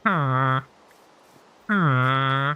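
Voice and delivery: low, droning villager "hmm"; wordless low villager hum; mock villager grunt. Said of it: "They can't even talk right. They're gonna go".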